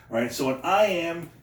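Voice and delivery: normal talking voice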